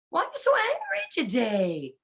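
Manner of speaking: normal talking voice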